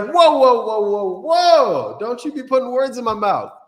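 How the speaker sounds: high, loud whoa-whoa protest; mock protest. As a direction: hold them back with a loud, high whoa. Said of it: "I was like".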